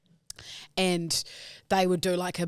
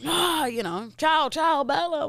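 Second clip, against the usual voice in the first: mock Italian; 10 semitones higher, 4 dB louder, slower than usual. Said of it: "going off in Italian".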